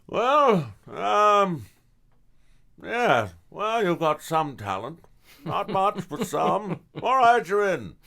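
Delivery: put-on high, loud old theater teacher; high, loud impression of the drama teacher; mentor's voice. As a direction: play the gruff-kind director granting entry. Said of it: "he goes".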